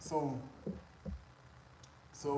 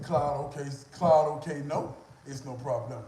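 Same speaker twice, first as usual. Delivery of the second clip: louder, firm and confident; confident loud reply; 10 dB louder, slower than usual. Retold or imitated. Louder confident reply to the woman in the restaurant. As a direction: say it back to her with loud confidence. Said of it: "That's how I said it".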